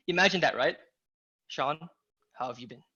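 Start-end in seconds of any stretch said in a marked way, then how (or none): 1.5-2.8 low, very quiet, menacing-thriller delivery; hushed and low, pause after the name; Liam Neeson. Shown as a demonstration. Coach imitating the Taken phone call tonality, low and mysterious. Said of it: "Imagine that tonality".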